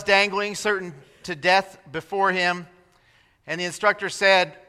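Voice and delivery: normal talking voice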